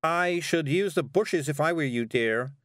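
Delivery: high, carrying call; calling after someone. A narrator's reading. Miss Level advises the girl to use the bushes, calling. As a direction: call it out helpfully after her. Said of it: "Miss Level called after her".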